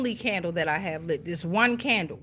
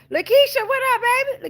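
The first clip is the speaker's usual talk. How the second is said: excited greeting; 12 semitones higher, 7 dB louder than usual. Real, not acted.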